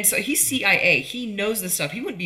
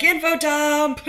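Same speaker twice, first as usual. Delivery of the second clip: high, slow, louder mock call of Info dump!; mock yell; 10 semitones higher, 7 dB louder, much slower than usual. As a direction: bellow Info dump! at the screen. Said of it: "And I'm like".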